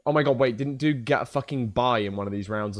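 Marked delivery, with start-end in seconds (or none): none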